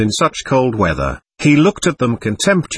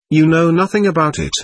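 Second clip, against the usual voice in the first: a touch of an irritated snap, slightly higher; irritable snap; 5 semitones higher than usual. A narrator's reading. Nastya snaps at her brother, mildly irritated. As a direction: snap it back, mildly annoyed. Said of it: "she snapped irritably".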